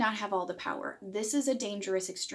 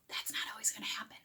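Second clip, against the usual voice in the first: whispered aside; 10 dB quieter, faster than usual. Real, not acted. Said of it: "whispered aside".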